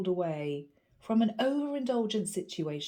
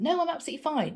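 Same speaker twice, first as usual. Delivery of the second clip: adamant denial; 6 semitones higher, much faster than usual. A narrator's reading. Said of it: "the guy was adamant".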